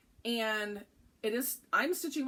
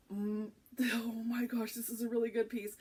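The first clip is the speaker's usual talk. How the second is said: giggly awed whisper; excited whisper. Real, not acted. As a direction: whisper in delight at the fabric. Awed over the fabric.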